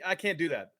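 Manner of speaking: usual speaking voice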